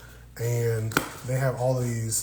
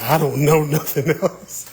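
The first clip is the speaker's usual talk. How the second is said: playful mimicry through laughs; laughing imitation; 3 semitones higher, 7 dB louder than usual. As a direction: tease them, cracking up mid-phrase. Teasing imitation of viewer, laughing.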